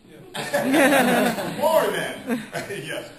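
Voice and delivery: high, loud, slow laughing reply. Real, not acted. Answering the interviewer, laughing high and loud. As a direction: laugh it out, delighted.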